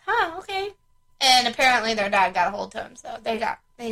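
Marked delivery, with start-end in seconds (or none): 0.0-0.7 mock surprise. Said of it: "I said".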